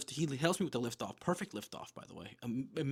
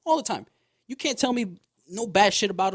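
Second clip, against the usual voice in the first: all the time, louder and much higher, punched; emphatic insistence; 7 semitones higher, 11 dB louder than usual. Real, not acted. Vouching for his friend Malik, loud and emphatic. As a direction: hammer home All the time.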